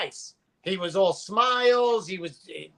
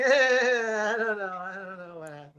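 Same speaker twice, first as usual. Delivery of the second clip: sheepish mumble; 3 semitones lower, 4 dB quieter than usual. Retold or imitated. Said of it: "He's like".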